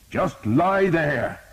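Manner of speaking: slow, high, loud command; shouting. A narrator's reading. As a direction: pin him down with your voice. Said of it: "a voice shouted".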